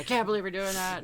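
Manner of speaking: high, loud mock outrage; offended girlfriend, mildly hammed; offended girl. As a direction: imitate her appalled tone. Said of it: "She's like".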